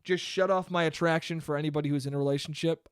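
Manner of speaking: ordinary talk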